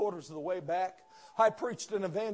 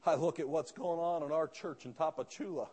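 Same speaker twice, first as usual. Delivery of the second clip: normal talking voice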